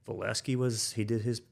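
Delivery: usual speaking voice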